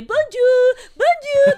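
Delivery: very high, loud tourist chirp; mock tourist. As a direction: sing out bonjour like a silly tourist. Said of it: "como turista tú no estás hablando con la gente por la calle y que". (turista exagerado saludando a gritos agudos)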